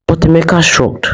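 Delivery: ordinary delivery, nothing marked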